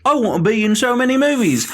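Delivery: high, lightly voiced pleading wish; a hint of an eager young actor; mock wish. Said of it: "he's like".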